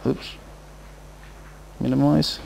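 usual speaking voice

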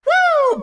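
high-pitched, thrilled "woo"; excited whoop. Acted. Announcer cheering a player's all-star move, excited whoop.

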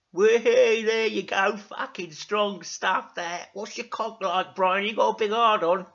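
loud, high, gleeful character voice; Dougal. Acted. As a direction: crow teasingly at a mate. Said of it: "said Dougal".

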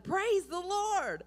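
high, loud, slow cry of praise; joyful shout. Retold or imitated. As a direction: shout it to the sky. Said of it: "and shouted".

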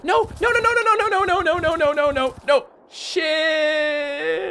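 very high, rapid panicked no's. Real, not acted. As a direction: cry out as it goes wrong. Plunging into shark mouth.

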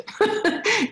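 real high-pitched laugh. Real, not acted. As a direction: burst out laughing. Laughs at her own joke.

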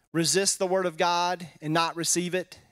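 ordinary talk

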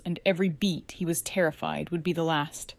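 ordinary delivery

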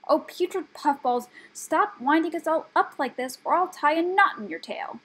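high, loud scolding; exasperated threat. A narrator's reading. Brownie threatens rat in exasperation.

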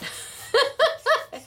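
a sudden high giggle; high laugh. Real, not acted. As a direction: laugh at your own joke. Laughs at the dryer tool.

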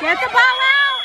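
urgent shout. Real, not acted.

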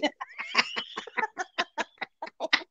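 high breathless laughter; long laugh. Real, not acted. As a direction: laugh helplessly at the clip. Laughing at a funny video. (carcajada aguda)

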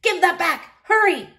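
high loud urgent yell; panicked shout. A narrator's reading. Horace panics.

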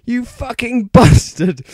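high, loud cursing yell, breaking into a laugh. A narrator's reading. Draco yelling at Vampire, laughing through it. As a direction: hurl the insult, barely holding back laughter. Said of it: "yelled Draco at Vampire".

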